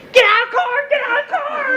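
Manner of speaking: very high strained yell; first officer screaming at the driver, frantic. Retold or imitated. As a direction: scream it in panic. Officer yells.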